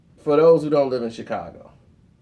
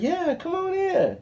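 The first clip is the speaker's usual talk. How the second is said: very high, eager invite; mild excited girlfriend; excited welcome; 14 semitones higher, 3 dB quieter than usual. Retold or imitated. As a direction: squeal a welcome. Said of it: "She was all excited".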